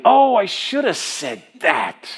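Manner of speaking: drawn-out, high-pitched self-reproach; hammed-up exasperation at yourself; mock regret. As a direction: kick yourself, slowly and theatrically. Preacher acts out belated regret.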